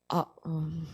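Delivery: imitated embarrassed mumble; very low, small and awkward. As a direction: shrink away under your breath. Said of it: "엄청 부끄러워했어요".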